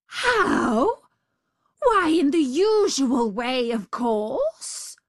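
outraged at the silly question; indignant tiger. Acted.

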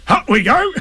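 cheerful, high-pitched self-congratulation; pleased, bouncy animal voice; happy Tigger. Acted. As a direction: crow it happily to yourself. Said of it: "Tigger said happily to himself".

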